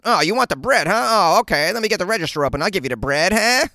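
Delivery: mock vintage voice with rising huh; old-timey old person. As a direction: answer like an obliging old-timer. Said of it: "It was another old person".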